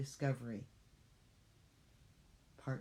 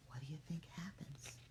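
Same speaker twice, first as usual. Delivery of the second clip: whisper; 12 dB quieter than usual. Real, not acted. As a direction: whisper it like a secret. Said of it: "asks kids, whispering".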